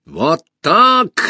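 high, loud triumphant burst; enraged shout. A narrator's reading. Usurper after shooting the empress, enraged shout. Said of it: "закричал в бешенстве узурпатор".

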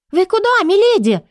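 high startled maid voice; alarmed maid. A narrator's reading. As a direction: squeak it out in alarm. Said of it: "всполошилась Мэри".

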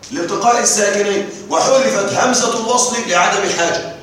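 usual speaking voice

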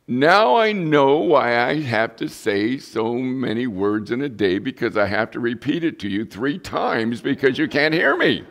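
wife's complaint. Retold or imitated. Wife teasing her husband across the table, mock-exasperated and loud. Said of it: "she said to me".

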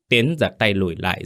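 ordinary delivery

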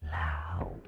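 put-on possessed girl hissing a name at her father; hissing word by word. A narrator's reading. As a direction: hiss each word like something possessed. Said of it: "rồi rít lên từng chữ".

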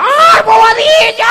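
llamado muy agudo, muy fuerte y estirado; grito imitado. Retold or imitated. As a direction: lo llama a gritos desde lejos. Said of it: "Yo voy bajando por la escalera". (la mujer grita su nombre desde abajo, muy agudo)

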